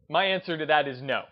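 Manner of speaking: normal talking voice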